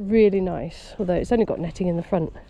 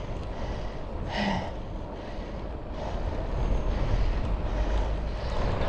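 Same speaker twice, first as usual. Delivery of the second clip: heavy sigh; 8 dB quieter than usual. Real, not acted. Climbing hill on bike.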